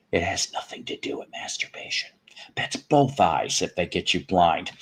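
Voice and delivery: half-whispered naughty aside; confidential whisper. Real, not acted. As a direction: drop to a hushed, confiding whisper.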